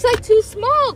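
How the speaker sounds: indignant outburst. Real, not acted. Complaining about the swimsuit.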